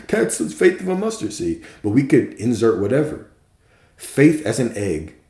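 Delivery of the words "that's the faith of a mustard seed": said through a laugh, pitched much higher. Real, not acted. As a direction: repeat it while laughing at your own line. Repeats line, second time laughing.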